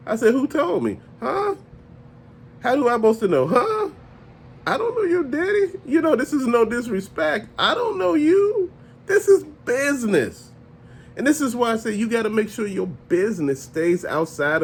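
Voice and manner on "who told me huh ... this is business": high, mocking put-on voice, dismissive; rude seller. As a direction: brush off the grieving customer, pitched way up. Seller's cold reply, retold.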